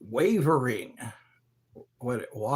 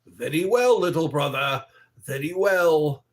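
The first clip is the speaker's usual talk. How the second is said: Clockwork Orange impression; 6 dB louder than usual. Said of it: "A Clockwork Orange".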